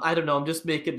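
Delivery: ordinary delivery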